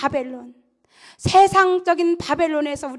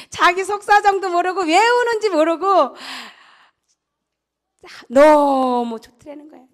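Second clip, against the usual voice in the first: ordinary talk, nothing marked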